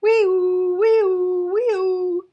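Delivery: very high, loud wailing siren; siren imitation. Shown as a demonstration. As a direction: wail like a fire truck siren. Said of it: "You can say that with me".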